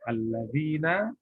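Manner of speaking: "alladzina" slowed, lengthening the "dzii"; long madd. Shown as a demonstration. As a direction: draw out the i vowel in "alladzina". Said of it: "panjangnya dua harokat".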